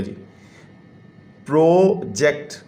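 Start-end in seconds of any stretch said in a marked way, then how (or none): 1.5-2.5 project in two beats, प्रो-जेक्ट; split into syllables. Shown as a demonstration. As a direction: hold pro, pause, then जेक्ट. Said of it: "दो syllable हो गए".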